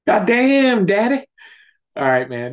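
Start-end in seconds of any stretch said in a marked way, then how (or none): 0.1-1.2 much higher, louder delighted whoop; excited outburst. Real, not acted. Host cheering the co-host's intro, loud and much higher. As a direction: burst out in delight at the co-host's bit.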